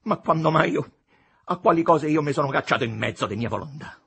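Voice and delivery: loud, high indignant self-defence; uncontrolled vexation. A narrator's reading. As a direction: burst out, unable to hold back. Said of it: "non riuscendo a frenar l'impeto della stizza". (sfogo di stizza incontenibile)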